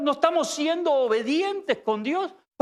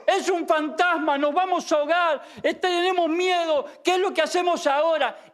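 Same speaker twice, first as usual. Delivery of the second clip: imagined panicked cries; 6 semitones higher than usual. Retold or imitated. Preacher imagines disciples screaming in the storm, high frightened cries. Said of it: "me imagino los gritos de ellos".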